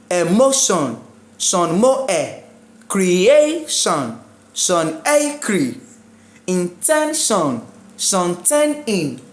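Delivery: slow split syllables, Sean B-Am; deliberate syllable-by-syllable Sean Ten In; counting syllables backwards. Shown as a demonstration. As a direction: say Emotion, then break it up from the end. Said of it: "start counting from the last syllable".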